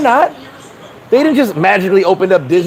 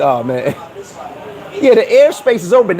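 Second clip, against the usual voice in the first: ordinary delivery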